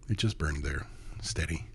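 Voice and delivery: plain reading voice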